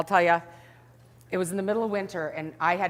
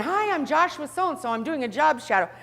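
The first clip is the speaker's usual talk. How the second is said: higher, chirpy intern voice; cheerful young trainee; 8 semitones higher than usual. Retold or imitated. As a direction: sound like the nicest eager kid. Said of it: "just the happiest, cheerfulest".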